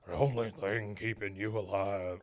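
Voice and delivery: low quiet gruff voice; the wrong voice, mumbled low; wrong character voice. Shown as a demonstration. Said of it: "I did the wrong voice".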